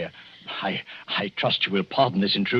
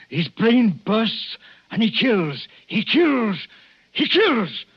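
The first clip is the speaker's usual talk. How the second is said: higher and more intense, building; speaking strongly; 9 semitones higher, much slower than usual. Acted. Doctor warns husband about the lunatic, rising intensity. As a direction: let it surge to a climax on kills. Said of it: "excuse me for speaking so strongly".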